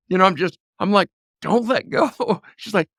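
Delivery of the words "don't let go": raised, higher urgent command; urgent order. Retold or imitated. Dad calling to his daughter in the crawl space, loud urgent order. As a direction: bark it out so she won't let go.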